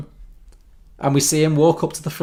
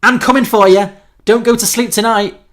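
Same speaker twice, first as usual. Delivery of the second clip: shouted threat in rough Scouse; chavvy Scouse accent; 8 semitones higher, 9 dB louder than usual. Acted. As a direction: yell it like a Scouse lad. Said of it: "I think I lost my accent a bit there".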